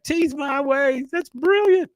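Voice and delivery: put-on very high, slow, thrilled boy's voice; delighted. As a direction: gush over the joke like an awestruck fan. Bobby delighted by the joke.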